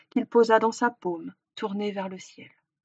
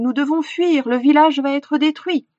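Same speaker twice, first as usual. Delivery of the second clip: urgent shout, higher and louder; crying out; 5 semitones higher, 6 dB louder than usual. A narrator's reading. Hunter urging villagers to flee, crying out. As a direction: sound the alarm to the whole village. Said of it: "cria-t-il".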